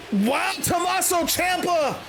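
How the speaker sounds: wow, yelled out high; excited shout. Real, not acted. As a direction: burst out at the sight of him. Surprised reaction to entrance.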